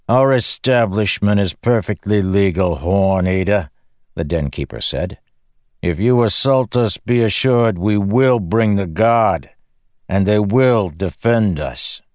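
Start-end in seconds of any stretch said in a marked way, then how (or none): 0.1-3.7 louder, stiff and haughty, a shopkeeper's voice; a prim proprietor looking down on the giant; haughty den keeper. Acted. The den keeper warns Rock off, haughty and loud. 5.8-12.0 louder, stiff and haughty, a shopkeeper's voice; a prim proprietor looking down on the giant; haughty den keeper. Acted. The den keeper warns Rock off, haughty and loud.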